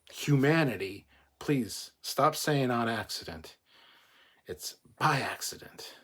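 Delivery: loud, emphatic pleading rant; real pet-peeve outburst, insistent and pleading; exasperated rant. Real, not acted. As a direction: rant loudly, punching the key words. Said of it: "went off on a little bit of a rant".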